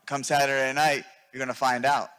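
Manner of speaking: usual speaking voice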